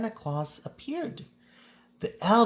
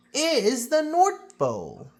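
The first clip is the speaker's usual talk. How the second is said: higher, fuller conductor announcing; 10 semitones higher, 5 dB louder than usual. A narrator's reading. Conductor points it out to the children, lightly announced. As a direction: proudly present the destination. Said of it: "said the conductor".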